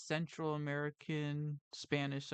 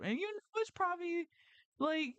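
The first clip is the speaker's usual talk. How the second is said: ordinary talk, nothing marked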